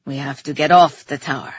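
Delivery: deep-voiced urgent warning; Theron's voice. A narrator's reading. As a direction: drop well down in pitch for a grave warning.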